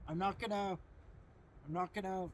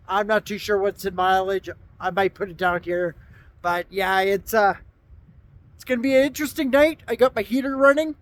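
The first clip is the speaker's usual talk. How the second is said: shouting over wind; 16 dB louder than usual. Real, not acted. Still outside in gusting wind.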